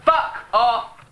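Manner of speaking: sudden loud high fuck off; loud playful rebuff; jokey shout. Real, not acted. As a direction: bark it back in mock outrage. Playful rebuff of teasing friend.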